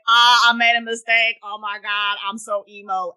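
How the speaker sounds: mock anguished wail, starting loud; mocking, hammed-up self-pity; melodramatic Luke. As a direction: whine it like an overdramatic hero. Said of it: "Luke was just like".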